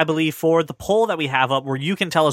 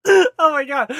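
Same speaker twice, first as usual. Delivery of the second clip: laughing; 10 semitones higher, slower than usual. Real, not acted. Burst after laughing fit.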